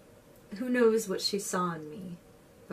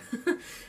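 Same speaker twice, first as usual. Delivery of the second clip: short breathy laugh. Real, not acted. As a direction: let out a quick laugh. Laugh after winning.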